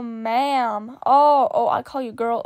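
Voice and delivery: ordinary delivery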